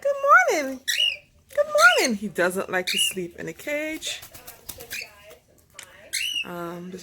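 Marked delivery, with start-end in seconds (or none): 0.0-2.2 cheery pet greeting. Real, not acted.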